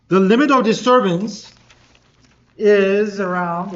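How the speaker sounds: loud and slow for the back row. Real, not acted. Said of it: "I will speak louder".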